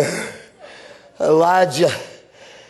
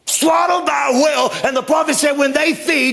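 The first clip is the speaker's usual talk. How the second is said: preaching at full force; 7 semitones higher than usual. Acted. Preacher telling the church about Jonah, full-force preaching. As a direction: drive the story home like a revival preacher.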